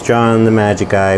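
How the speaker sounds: ordinary talk, nothing marked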